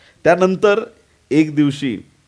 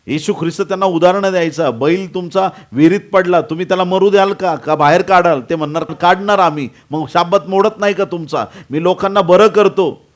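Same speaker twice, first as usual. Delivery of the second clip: raised, loud sermon argument; high-energy preacher's delivery; forceful preaching; 7 semitones higher, 6 dB louder than usual. Acted. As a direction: press each challenge home from the pulpit.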